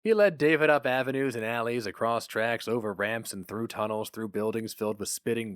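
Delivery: usual speaking voice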